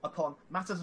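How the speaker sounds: lightly put-on posh English lady greeting a guard; a touch of upper-class Jane Eyre airs; posh girl voice. Reporter bluffing past security guards, in a light posh voice. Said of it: "I'm gonna do my posh girl voice".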